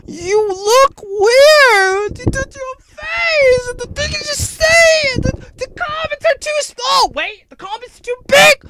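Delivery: hammed-up high-pitched screaming rant; screaming critic. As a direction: shriek it like an unhinged online commenter. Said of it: "and be like".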